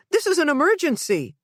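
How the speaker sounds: high, pressing demand; insistent. A narrator's reading. Mother to the operator, insistent. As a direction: stress the emergency.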